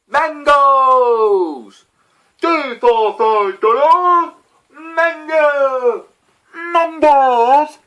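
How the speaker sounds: very high, very loud street-hawker cry of mangoes; market vendor yelling. Retold or imitated. Imitating the fruit market guy yelling at passers-by, loud hawker cry. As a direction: belt it out like a fruit-stall hawker. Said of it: "there's this one dude that just yells".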